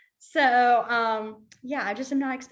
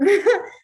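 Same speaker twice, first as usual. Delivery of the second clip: bright laugh; 9 semitones higher, 9 dB louder than usual. Real, not acted. Laughs at odd sign.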